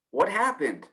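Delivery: ordinary delivery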